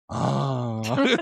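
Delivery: drawn-out "oh" after a gasp; gasp, then let the realization sink in, lightly acted; retold dawning realization. Host voicing him as it dawns on him, drawn-out realization.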